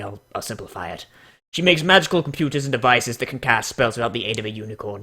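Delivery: normal talking voice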